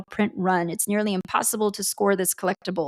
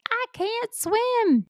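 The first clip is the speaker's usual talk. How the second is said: very high, slow mock whine; mock helpless; much slower than usual. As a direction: whimper it in a silly high voice. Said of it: "she's gonna be like".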